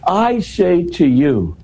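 "I" stressed, high and slow; emphatic I. Shown as a demonstration. Showing the listener Jesus's emphatic ego: "I" stressed high and slow. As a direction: lean hard on "I" in "I say to you", slowed right down. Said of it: "I'm putting the emphasis on the me here".

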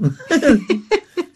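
high burst of laughter; real amused laugh. Real, not acted. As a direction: laugh at getting lost in the argument. Laughs after admitting being lost.

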